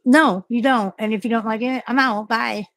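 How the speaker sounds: loud, high, defiant brush-off; defiant retort. Pretend. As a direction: tell the boss off and walk out. Acted retort to pushy managers.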